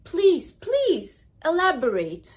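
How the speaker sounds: mock polite challenge. Shown as a demonstration. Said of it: "why did you say that".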